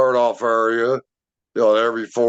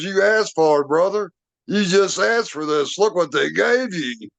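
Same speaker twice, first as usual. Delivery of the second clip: higher, amazed buddy voice; a friend marveling at what just happened; excited friend; 6 semitones higher than usual. Retold or imitated. Arnie telling Greg he asked for this, in excited awe. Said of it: "he goes".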